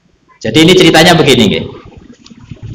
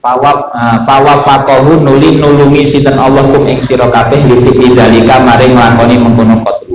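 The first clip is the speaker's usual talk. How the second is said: steady, level-toned reading, lower than the talk; scripture recitation with gloss; 4 semitones lower than usual. A narrator's reading. Teacher reading Arabic text with Javanese translation, steady intoned recitation. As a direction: intone the text phrase by phrase in a monotone.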